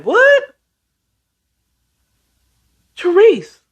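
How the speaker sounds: very high, rising stunned reaction; shocked disbelief. Retold or imitated. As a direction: imitate a mom who can't believe it. Acted mother reacting to shocking news.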